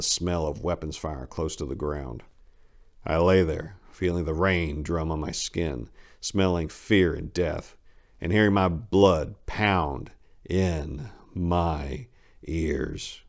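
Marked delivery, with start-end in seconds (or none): none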